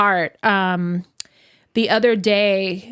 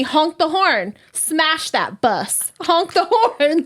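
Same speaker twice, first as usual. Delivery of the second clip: put-on high child's voice, laughing through it; little girl cheering for road rage; kid voice; 7 semitones higher, slower than usual. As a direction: mimic a toddler urging mom on. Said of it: "she keeps telling me".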